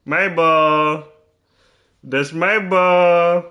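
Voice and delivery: playful gloat over the ball; teasing claim. Real, not acted. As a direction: stretch it out smugly.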